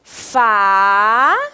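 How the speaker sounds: long rising FÁ, a gap, then DA; syllable by syllable. Shown as a demonstration. Teacher pronouncing the two syllables for the class, syllable by syllable. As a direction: sound out "Fá... da" in two drawn-out pieces. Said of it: "pronunciar as duas sílabas juntas".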